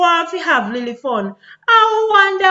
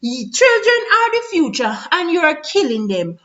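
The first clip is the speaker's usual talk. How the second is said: normal talking voice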